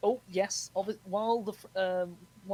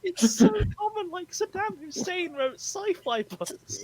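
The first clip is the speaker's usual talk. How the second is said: high, slow words squeezed through laughter; helpless laughter; 13 semitones higher, 8 dB louder, slower than usual. Real, not acted. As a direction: barely get it out while cracking up. Cracks up at dictators writing novels.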